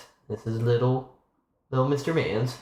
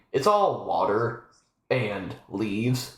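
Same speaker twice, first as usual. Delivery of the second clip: ordinary delivery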